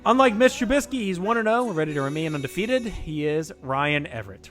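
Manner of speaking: upbeat host intro; game show introduction. Acted. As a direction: hype up the contestant. Contestant introduction.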